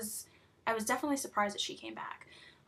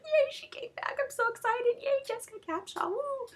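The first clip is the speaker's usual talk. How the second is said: much higher, gushing cheer; fan squealing; 14 semitones higher than usual. Retold or imitated. Fan cheering a character's return, high squeal. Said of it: "I was like".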